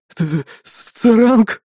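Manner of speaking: stammering through chattering teeth; slow, stuttering in terror. Acted. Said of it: "Его зубы стучали". (стуча зубами от страха)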